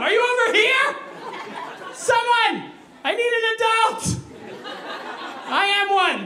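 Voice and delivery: high-pitched, panicky calling out; yelling into darkness. Acted. Lost diner needing the bathroom, yelling into the darkness. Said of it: "So I just yelled into the darkness".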